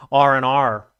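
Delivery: "R N R" loud through a laugh, slow; laughing American letters. Shown as a demonstration. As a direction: laugh out "R N R" so it sounds like Aussie "oh no". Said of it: "Oh, no," in Australian".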